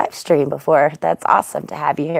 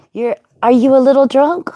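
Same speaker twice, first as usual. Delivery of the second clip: ordinary talk, nothing marked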